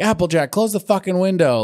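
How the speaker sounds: high-pitched mock yelling; retold mild yell. Podcaster retelling a cartoon pony yelling at Applejack, mild mock yell. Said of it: "Rarity starts yelling".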